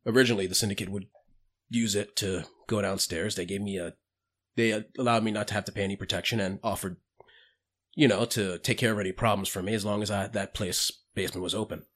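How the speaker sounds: put-on low-key bartender recounting old business; lightly voiced bartender. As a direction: lightly play a reticent bar owner, kept soft. Plays bartender character explaining the tunnel.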